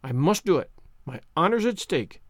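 understated solemn resolve; measured, mildly grave declaration. A narrator's reading. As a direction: state it gravely, as a matter of honor. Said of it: "said Anne solemnly".

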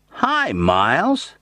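high, slow, delighted greeting; pleased and cheerful. A narrator's reading. Ben hearing Miles on the phone: pleased, cheerful. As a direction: greet an old friend with sudden pleasure. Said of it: "The voice was suddenly pleased and cheerful".

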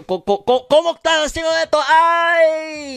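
high, loud, fast mock dismay; hammed-up outburst. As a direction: squeal it in exaggerated disbelief.

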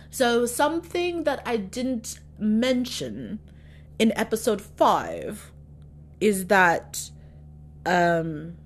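slow, deliberate fake British accent; bad British accent. Shown as a demonstration. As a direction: labour through a British accent under pressure. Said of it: "my British accent is not bad, but it's, it's, like, the worst".

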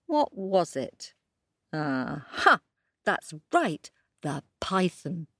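plain reading voice

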